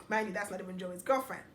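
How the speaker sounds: ordinary delivery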